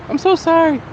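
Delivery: lightly mimicking her sorry; apologetic woman.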